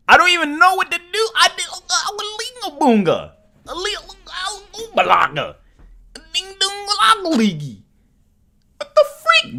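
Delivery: very high, frantic comic voice; squeaky gibberish. Acted. Streamer lost for words, squeaky frantic babble. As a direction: squeal nonsense at the top of your range.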